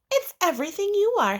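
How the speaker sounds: high, fast, loving voice; reassuring mother. Acted. Mother telling her daughter the name fits her.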